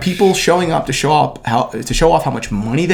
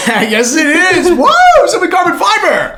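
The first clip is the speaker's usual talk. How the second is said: high loud sponsor cheer; hyped shout; 5 dB louder, slower than usual. Real, not acted.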